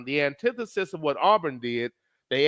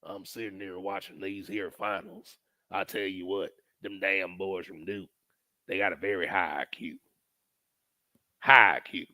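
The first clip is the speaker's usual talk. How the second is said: put-on old-timer fan voice; 10 semitones lower, 7 dB quieter than usual. Impersonating an old Duke fan.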